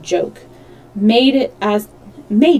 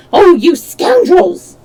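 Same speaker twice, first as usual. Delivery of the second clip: higher, louder, slower shriek of scoundrels; mock yell; 9 semitones higher, 7 dB louder, slower than usual. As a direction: yell it out like a locked-up old woman. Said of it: "hear her yelling".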